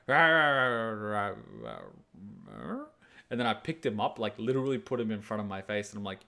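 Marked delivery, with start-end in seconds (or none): 0.1-2.8 mock growling that dies away; tiring dog snarl. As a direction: imitate a dog running out of fight. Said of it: "he was like".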